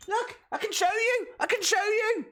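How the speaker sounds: high-pitched "I'll prove it" protest; insistent protest. Real, not acted. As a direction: push it high, desperate to prove the point. Insisting his pronunciation is right.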